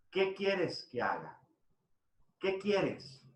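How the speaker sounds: soft, unhurried asking; gentle quoted question. Retold or imitated. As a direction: ask softly, voicing the Lord to a blind man. Jesus gently asks the blind man. (pregunta suave, imitada)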